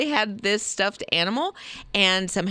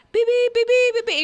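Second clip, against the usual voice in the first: high, loud machine beeping; rapid high-pitched robot beeps; imitated robot beeps; 6 dB louder than usual. Host mimics a floor sweeper following shoppers, high robotic beeping.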